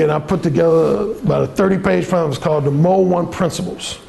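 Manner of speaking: normal talking voice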